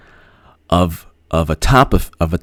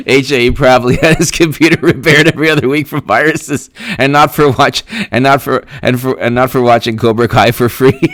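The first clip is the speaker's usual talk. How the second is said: higher voice breaking into laughter; laughing; 5 semitones higher, 3 dB louder than usual. Real, not acted. Streamer reading a joke about Cobra Kai, laughing. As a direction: struggle to get it out through real laughter.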